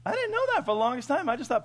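ordinary delivery

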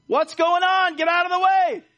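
high, very loud impatient shout; mimicked driver's yell. Children echoing Dad stuck in traffic, mimicked impatient yell. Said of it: "have my reflection heard from my children".

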